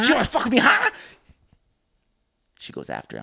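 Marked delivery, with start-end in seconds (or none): 0.0-0.9 high-pitched, hammed-up yell; over-the-top confrontational squeal; escalated mock challenge. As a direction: screech it like a movie showdown. Same challenge repeated, escalated.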